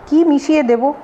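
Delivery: mildly astonished question; surprised. A narrator's reading. Accused host asking what he would mix in, mildly surprised. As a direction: ask back with a touch of surprise. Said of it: "অবাক হয়ে জিজ্ঞেস করল".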